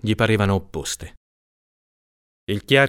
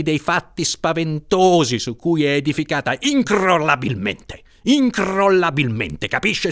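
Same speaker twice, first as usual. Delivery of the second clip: higher, insistent, slowing on key words; grim jettatore tirade; 6 semitones higher than usual. Acted. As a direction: hammer home his dark grievance. Chiarchiaro's grim tirade voiced.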